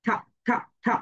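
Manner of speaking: থা repeated with aspirated t; letter sound tha. Shown as a demonstration. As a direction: drill "থা". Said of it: "ঠি sounds like থা".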